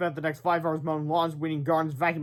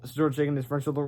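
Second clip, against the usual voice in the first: ordinary delivery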